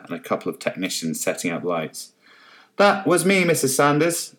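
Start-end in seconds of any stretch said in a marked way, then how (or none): 2.8-4.4 high, loud call across the yard; shouted back. A narrator's reading. Journalist calling out to Carol from the lights, high and loud. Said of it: "shouted back the journalist".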